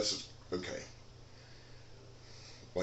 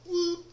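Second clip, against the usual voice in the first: sudden yelped whoop, much higher; whoop of a swing; 4 dB louder than usual. Pretend. Showing viewers a defensive swing, sudden high whoop. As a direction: yelp it out like a blade swinging.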